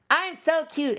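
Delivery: high squeaky cute plush voice; cute toy voice. Pretend. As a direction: speak as a tiny adorable toy.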